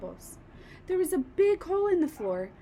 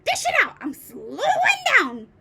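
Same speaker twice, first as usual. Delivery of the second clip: high, loud demanding crone; greedy yell; 8 semitones higher, 9 dB louder than usual. A narrator's reading. Grandma demanding a dose from George, yelling. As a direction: yell for more like a greedy old witch. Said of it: "she yelled".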